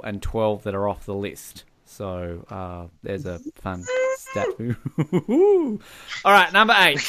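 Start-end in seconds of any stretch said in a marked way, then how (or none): 4.5-5.8 high, hooting hoo-hoo laugh; Tigger-like laugh. Real, not acted. Host tickled by a fun stat, Tigger laugh. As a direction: laugh in bouncy, high hoots. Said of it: "you totally did the Tigger laugh there".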